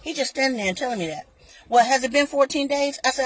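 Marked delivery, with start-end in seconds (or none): 1.7-3.0 higher, louder clerk voice; mock store rep. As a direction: lightly play the pushy clerk.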